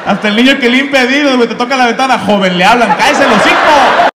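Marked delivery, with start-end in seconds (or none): 2.2-4.1 slow, loud, mocking; a shameless street kid barking the insult; loud rude kid. The kid cleaning windows telling the driver off, a loud mock insult.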